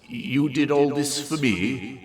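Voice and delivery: slow, sputtered disbelief; barely believe the gesture; touched disbelief. Acted. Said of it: "she sputtered".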